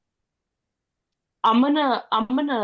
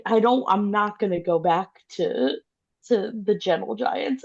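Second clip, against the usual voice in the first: normal talking voice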